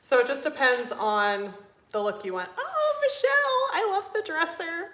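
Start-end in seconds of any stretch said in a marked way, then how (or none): 2.6-4.9 delighted gushing. Real, not acted. Host spotting a viewer in chat, high delighted gush. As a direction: coo high over a friend's work.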